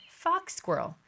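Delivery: plain reading voice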